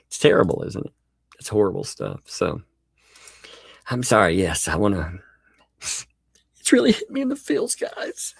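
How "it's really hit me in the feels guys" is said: hammed-up teary voice, much higher; mock heartbroken. Streamer hams up heartbreak for his chat, pitched high and teary. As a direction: fake a wounded little cry.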